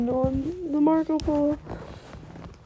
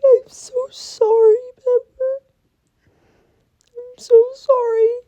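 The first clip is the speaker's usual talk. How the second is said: parents' apology said through real tears, higher; tearful quote; 8 semitones higher, 4 dB louder than usual. Real, not acted. Retelling her parents' apology to the pet, crying. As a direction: weep through the comforting words.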